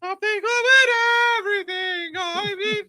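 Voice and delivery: high, held singing; Julia Child voice. Pretend. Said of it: "you sound like Julia Child".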